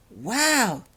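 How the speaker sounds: amazed. Acted. Holly sees the door creak open, amazed wow. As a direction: gasp at the opening door. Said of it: "said Holly in amazement".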